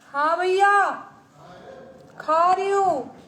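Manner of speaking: loud, high shout to brother in another room; calling out. Real, not acted. Answers brother calling her to eat.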